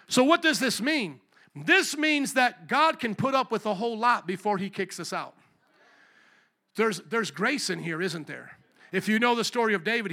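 ordinary delivery

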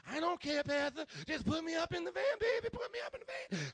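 put-on very high, loud kid voice; squealing, mock kid impression; eager kid. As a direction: beg excitedly like a kid. Voices a girl begging the pastor.

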